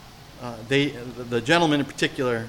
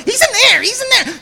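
shrill, much higher, loud squawking parrot cry with a laugh; 7 dB louder than usual. Retold or imitated. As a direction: squawk it out like a cartoon parrot tattling. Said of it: "the parrot would go".

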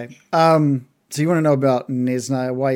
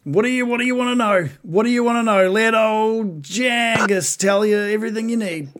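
high-pitched, obliging creature voice; old bugbear Jangus; 8 semitones higher than usual. Acted. Captive bugbear ready to tell everything, obliging. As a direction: offer to spill it all, pitched up.

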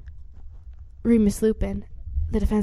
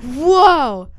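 a shocked whoa, louder and higher; 6 semitones higher, 10 dB louder than usual. Retold or imitated. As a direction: let the amazement peak. Kids react to Sirius appearing.

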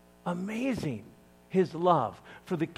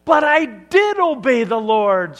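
loud, higher protest; a touch of shouted denial; insisting; 6 semitones higher, 10 dB louder than usual. Acted. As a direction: defend yourself insistently. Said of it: "Saul insisted".

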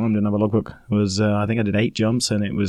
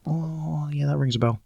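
sheepish recall; 5 semitones higher, 5 dB quieter than usual. Retold or imitated. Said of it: "And I was like".

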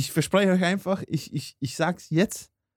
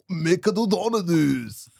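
slow mock Japanese accent; pseudo-Japanese name, drawn out; fake Japanese accent; 4 semitones higher, slower than usual. Shown as a demonstration. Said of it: "ohne dass ihr gleich".

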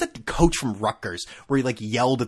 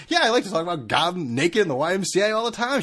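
mild mock yell; 6 semitones higher, 3 dB louder than usual. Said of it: "I'm kinda yelling today".

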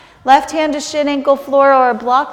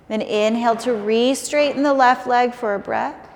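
ordinary talk, nothing marked

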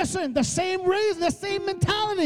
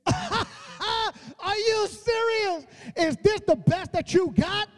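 higher, slow, laughing scorn; mocking Goliath; 3 semitones higher, slower than usual. Giant Goliath mocking young David, high and scornful. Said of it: "Goliath looked at this one and said".

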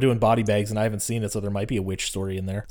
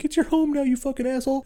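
much higher, shrill retort; angry sister; faster than usual. Retold or imitated. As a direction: throw it back at him hard. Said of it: "And she's like".

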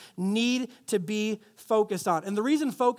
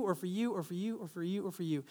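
each "or for you" softer, trailing off; fading dismissals; 3 semitones lower, 9 dB quieter than usual. Pretend. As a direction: wave them off one by one, fading away. Dismissing suitors one by one.

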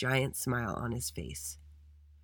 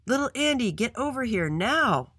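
shouting; 8 semitones higher than usual. A narrator's reading. Principal to Andy on the playground, shouting. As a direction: summon with a high, stretched shout. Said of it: "blew her whistle and shouted".

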